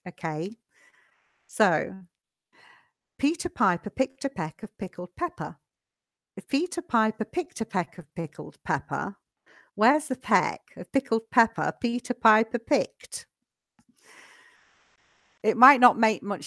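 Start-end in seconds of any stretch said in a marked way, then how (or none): 3.2-13.2 tongue twister at a brisk, flowing pace; quite quick. Shown as a demonstration. Teacher shows students a tongue twister first, quite quickly. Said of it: "quite quickly".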